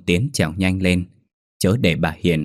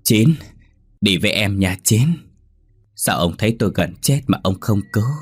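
lightly voiced vengeful ghost luring its victim; ghost calling out; 2 semitones higher than usual. A narrator's reading. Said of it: "Hiền lúc này cười phá lên".

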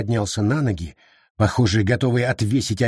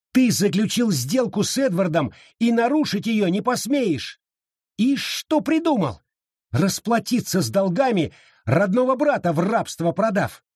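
high-pitched, outraged tirade; heated accusation; 9 semitones higher than usual. A narrator's reading. Abita accuses Wallace of selling his brother, outraged. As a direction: fling accusations without backing down.

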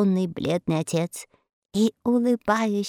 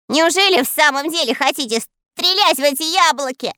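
high, louder scared plea; frightened whine; 8 semitones higher, 4 dB louder than usual. A narrator's reading. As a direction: whimper in terror. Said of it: "плакался насмерть испуганный трусишка". (испуганно хнычет)

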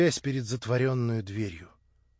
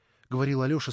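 plain reading voice

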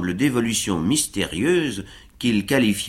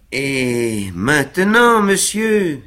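Sam urging him on, higher and louder; encouraging Sam; 5 semitones higher, 4 dB louder, much slower than usual. A narrator's reading. Sam urging his shaky master onto the ice, encouraging. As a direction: coax him forward cheerfully. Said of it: "sur un ton encourageant".

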